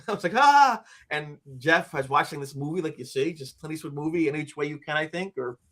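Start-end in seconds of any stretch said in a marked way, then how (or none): 0.3-0.8 high, loud mock shriek; short imitated cry of fright; scared gasp-scream. Showing how scared he was, mock shriek. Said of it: "I was scared blankless".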